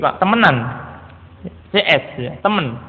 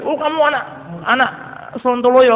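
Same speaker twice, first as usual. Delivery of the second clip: much higher pitched, hammed-up name-calling; mock insulting rebuke; 7 semitones higher than usual. Father insulting his son, mock angry example. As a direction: sneer at the son as the bad example. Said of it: "Nggak gitu".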